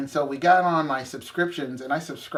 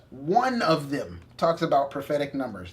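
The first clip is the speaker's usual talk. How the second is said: usual speaking voice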